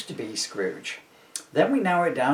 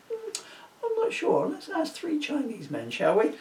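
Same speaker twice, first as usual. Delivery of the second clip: high put-on voice; 13 semitones higher, 4 dB quieter than usual. Spirit mimics a figure in quotes.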